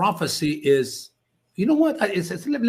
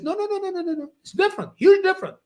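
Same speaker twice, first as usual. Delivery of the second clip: protesting lady's voice, much higher; voicing the lady; 14 semitones higher, faster than usual. Lady protesting the comparison, pitched high. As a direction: deny it fast in a high voice. Retold talk with Iranian lady.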